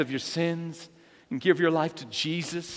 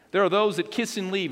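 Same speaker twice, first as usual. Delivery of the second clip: normal talking voice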